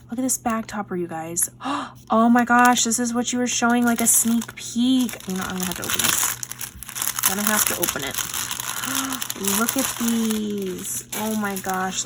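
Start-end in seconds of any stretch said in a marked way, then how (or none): 1.5-2.0 quick, soft gasp of delight; sudden delighted intake of breath; excited gasp. Real, not acted. Delighted reaction to bag topper. 8.2-9.5 long, soft gasp of wonder; awed intake of breath; delighted gasp. Real, not acted. Delighted reaction on opening the gift.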